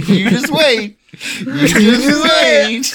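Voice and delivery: slow, high, menacing; ominous warning. Pretend. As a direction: drag out the threat. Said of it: "I'm like".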